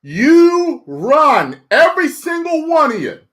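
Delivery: loud, high hyped yell; shout. Acted. Streamer yelling at chat to run.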